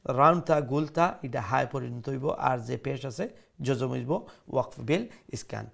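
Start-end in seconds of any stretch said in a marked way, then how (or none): none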